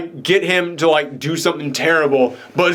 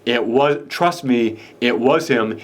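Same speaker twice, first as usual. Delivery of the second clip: lower, insistent retold denial; 5 semitones lower, slower than usual. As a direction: imitate her firmly insisting. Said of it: "And she's saying".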